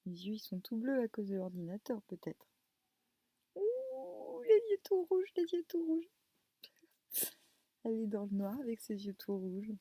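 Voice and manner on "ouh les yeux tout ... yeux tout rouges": high, loud squeal of delight to a pet; squealing baby talk. Acted. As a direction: coo it out sky-high like to a kitten. Squeals at the cat.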